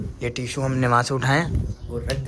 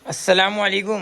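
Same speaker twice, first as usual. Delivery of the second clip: higher-pitched "अस्सलाम वालेकुम"; raised, insistent greeting; 5 semitones higher, 4 dB louder than usual. Real, not acted. Uncle prompting child to answer salaam, raised pitch. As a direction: repeat the greeting up high, prompting a reply.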